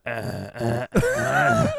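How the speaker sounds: imitating zombies grunting, pitch jumping up; mock zombie groans. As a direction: grunt like lazy zombies entering the ring. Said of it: "part where the zombies like".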